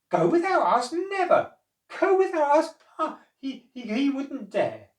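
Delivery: very high, loud mocking squeal; scoffing monkeys. A narrator's reading. Monkeys laughing off Noah's threat, high and squealing.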